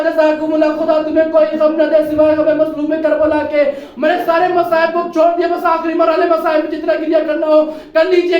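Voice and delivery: normal talking voice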